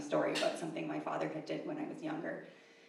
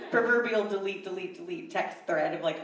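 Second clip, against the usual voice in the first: normal talking voice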